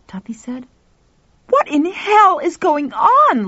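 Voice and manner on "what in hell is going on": slow, loud, high screech; demanding shout. A narrator's reading. Lauren confronting Pal and Tuffy, screeching demand. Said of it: "Lauren demanded".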